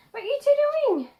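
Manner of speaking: high, loud questioning call. Real, not acted. Vlogger breaking off to ask two others what they're doing, calling out. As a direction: call across to them, pitch jumping up.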